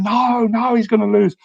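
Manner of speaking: retold panic. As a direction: replay your younger self panicking at the radio. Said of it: "I was going".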